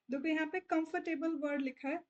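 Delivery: normal talking voice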